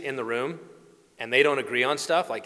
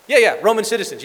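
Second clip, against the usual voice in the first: higher and louder, pressing the point; Paul baiting the guard, higher and louder; eager mock emphasis; 7 semitones higher, 6 dB louder, faster than usual. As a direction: push it like a sly lawyer.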